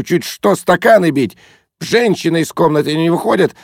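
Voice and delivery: high, ranting reproach; indignant. A narrator's reading. Guest complaining to the girl about his friend, indignant. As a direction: rant in exasperated indignation. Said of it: "с непонятным чувством возмущения".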